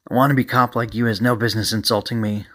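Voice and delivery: plain reading voice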